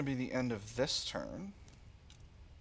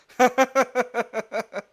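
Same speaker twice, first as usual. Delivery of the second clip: high loud laugh; burst of laughter; 12 semitones higher, 11 dB louder than usual. Real, not acted. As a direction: laugh out at the joke. Laughs at Baker Street address.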